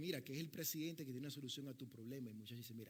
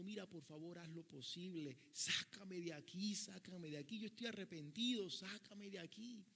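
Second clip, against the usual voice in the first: súplica aguda que se va desesperando; llanto suplicante imitado; 5 semitones higher than usual. Retold or imitated. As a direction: imita a un muchacho que ruega llorando, con la voz cada vez más alta. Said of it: "el muchacho estaba llorando y llorando". (ruego lloroso actuado, en tono agudo)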